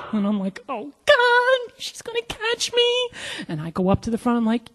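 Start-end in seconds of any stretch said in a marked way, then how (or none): 0.7-3.1 very high, small panicked squeal; comic panic. Retold or imitated. Agent summoned by her supervisor, panicked. Afraid of being caught.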